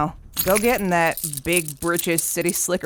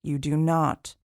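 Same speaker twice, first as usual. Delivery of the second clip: hushed warning; 2 semitones lower, 7 dB quieter than usual. Acted. Hawthorne warning the PI before leaving, hushed and grave. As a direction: lean in and warn him gravely.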